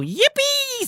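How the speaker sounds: very high squeal of yippee; excited cheer. A narrator's reading. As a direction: squeal with joy. Pim Pim cheers.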